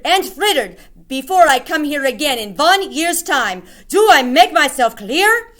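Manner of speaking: high, loud shrieking commands; witch shrieking orders. Acted. Grand High Witch shrieking orders to every witch. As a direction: bark orders that allow no question. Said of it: "shrieked the Grand High Witch".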